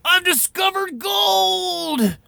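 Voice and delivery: put-on high, slow old-timer shout; drawn-out excited miner yell; old prospector. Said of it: "Uncle Sage, the old prospector".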